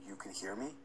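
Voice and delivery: soft mumbled question. Retold or imitated. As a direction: mumble it under your breath, scared. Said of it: "mumbled the words".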